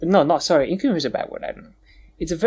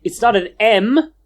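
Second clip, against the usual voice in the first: loud, high insulting retort; exasperated correction; 4 semitones higher, 5 dB louder than usual. Real, not acted. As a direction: argue it loudly at a stubborn friend. Insults friend while correcting them.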